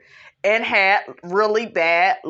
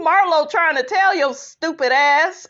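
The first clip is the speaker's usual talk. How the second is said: normal talking voice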